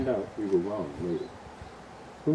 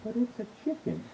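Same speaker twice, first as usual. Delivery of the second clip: near tears; 12 semitones higher than usual. Acted. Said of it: "wife almost in tears".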